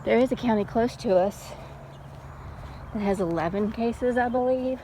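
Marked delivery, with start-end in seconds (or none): none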